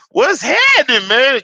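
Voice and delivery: high, loud hype greeting. Acted. Greeting his viewers, loud hype shout. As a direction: boom it out like a show host.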